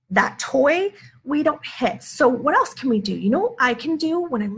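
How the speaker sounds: coaching parent. Shown as a demonstration. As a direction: lift the pitch and steady a child who wants to hit. Mom modeling words to angry child.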